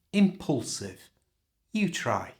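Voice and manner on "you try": normal talking voice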